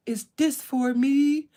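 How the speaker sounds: put-on slow, lightly deepened little boy; deep kid voice. Nephew opening his gift, lightly deepened voice. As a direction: drop the voice for the nephew. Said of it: "when you guys hear me imitate them and I drop my voice".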